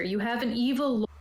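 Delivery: ordinary talk, nothing marked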